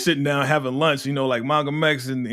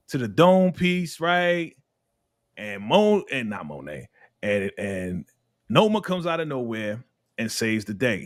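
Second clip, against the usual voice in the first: normal talking voice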